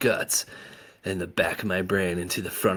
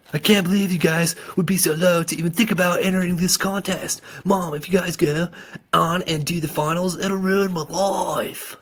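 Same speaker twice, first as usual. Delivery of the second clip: high, loud angry accusation, slowing at the end; furious outburst; 11 semitones higher, 6 dB louder than usual. A narrator's reading. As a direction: let the anger pour out at mom. Son furious at mother.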